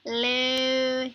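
loud greeting voice. Acted. Shopkeeper greeting a customer in the game, loud put-on voice. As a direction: call out a big shop greeting.

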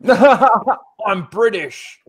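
drawn-out, high, loud mock British accent; laughing, hammed-up British accent. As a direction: stretch it out as a British parody. Mocking friend's British-sounding impression.

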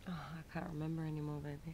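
soft breathy whisper. Real, not acted. Partner quietly answering his question about mushrooms, whispered. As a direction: whisper it close so only he hears.

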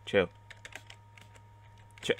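ordinary talk

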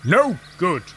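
a mild, sulky grumble; grumbling. A narrator's reading. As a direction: grumble it without hope. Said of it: "grumbled Gordon".

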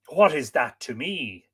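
usual speaking voice

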